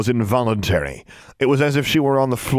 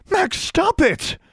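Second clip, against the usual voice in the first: high, drawn-out cry; urgent plea; much slower than usual. A narrator's reading. Girl calls her rescuer off the bully, pleading. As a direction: cry out to stop him, pitch soaring.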